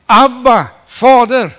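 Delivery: loud, high cry to the Father. Acted. The Son's spirit crying out to the Father, loud high call. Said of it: "som ropar".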